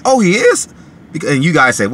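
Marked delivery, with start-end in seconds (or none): 0.0-0.7 very high, louder shocked "Oh, he is?"; "Oh, he is?" jumps way up in pitch, like he can't believe it; surprised. Retold or imitated. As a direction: squeak out "Oh, he is?" in disbelief. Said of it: "I said".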